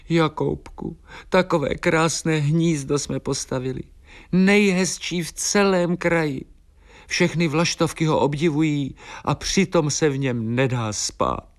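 high, plaintive little swallow; Eliška whimpering. A narrator's reading. Swallow complains that their lovely nest is unsleepable, high whimper. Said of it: "pofňukávala Eliška".

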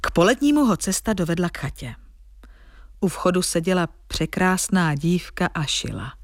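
plain reading voice

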